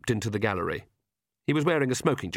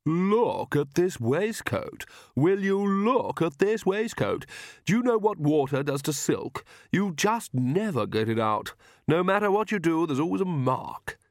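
high, slow, fussing over the ruined silk waistcoat; a prissy young vampire mourning his silk; fussy vampire dandy; 7 semitones higher, slower than usual. Acted. Vlad to Agnes, fretting over his wet waistcoat, high and fussy.

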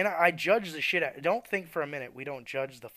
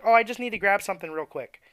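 mock late shopper; 6 semitones higher, 9 dB louder than usual. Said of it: "someone's like".

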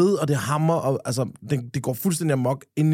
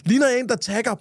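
ordinary delivery

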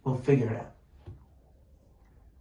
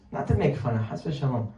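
ordinary talk, nothing marked

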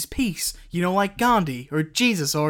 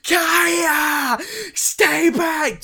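much higher, crying shout; desperate scream; 11 semitones higher, much slower than usual. Acted. Warning Kaya to stay back, a higher crying scream. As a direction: yell a warning across the graveyard. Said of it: "You scream out".

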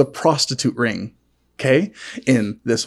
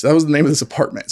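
normal talking voice

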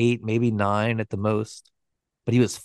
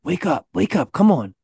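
urgent wake-up; 5 semitones higher, 4 dB louder than usual. A narrator's reading. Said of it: "shook Sanford awake".

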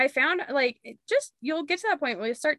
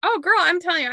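ordinary delivery

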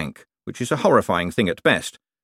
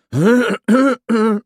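loud, repeated throat-clearing; forceful acted throat-clearing; loud pointed cough; 14 semitones higher, 7 dB louder than usual. A narrator's reading. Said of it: "Igor coughed loudly".

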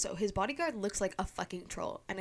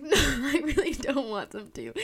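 higher, louder, laughing through it; laughing refusal; 6 semitones higher, 7 dB louder than usual. Retold or imitated. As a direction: refuse while cracking up. Threatened by troll bodyguard.

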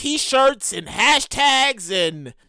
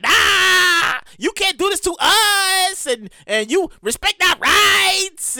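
mock protest shout; 6 semitones higher, much slower than usual. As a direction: shout back at the riot squad. Protesters voiced shouting at police.

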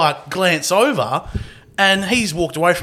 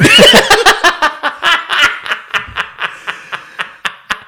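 long loud laugh; 11 semitones higher, 5 dB louder than usual. Real, not acted. Laughing after own punchline.

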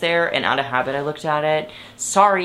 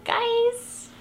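high squeal; 4 dB quieter than usual. Real, not acted. Playful call to fix AC.

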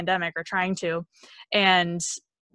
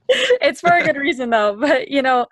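laughing; 6 semitones higher, 6 dB louder than usual. Real, not acted. Laughs at own hyping.

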